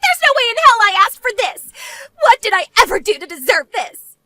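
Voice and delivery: loud, acted protest of injustice; indignant outburst. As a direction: burst out in wounded outrage. Eleanor protests in acted quarrel.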